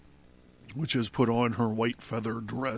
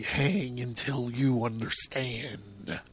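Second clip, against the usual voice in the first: slow, soft, menacing verdict; hushed cruelty, words stretched out; 2 semitones lower, 5 dB quieter, slower than usual. A narrator's reading. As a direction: draw it out quietly with cold relish. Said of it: "who says cruelly".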